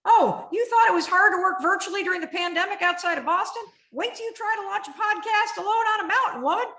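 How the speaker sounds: high, fast, loud mocking taunt; mocking inner critic. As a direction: taunt yourself like a nasty inner voice. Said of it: "she's like".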